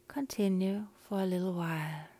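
ordinary talk, nothing marked

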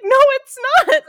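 high, loud hammed-up retort; mock contradiction. Theater staff correct a customer, mock outraged. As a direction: shoot back loudly, imitating outraged staff. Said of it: "And they're like".

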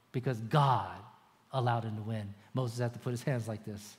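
ordinary talk, nothing marked

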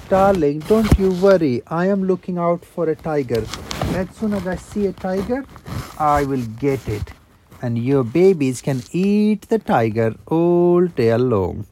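wolf shouting down from the cave top; calling out loud. Acted. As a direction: boom it out for the tiger to hear. Wolf calling back, for tiger.

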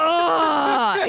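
exasperated shout. Real, not acted. Annoyed at husband's hugs.

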